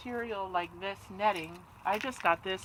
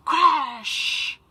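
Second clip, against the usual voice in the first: loud, high sound-effect crash; 6 semitones higher, 8 dB louder than usual. Acted. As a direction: slam the word like an impact. Bird bumps into house.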